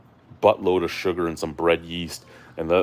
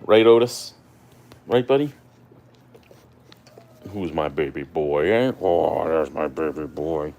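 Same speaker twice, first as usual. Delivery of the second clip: talking to a dog; 3 dB louder than usual. Real, not acted. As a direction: coo at the puppy.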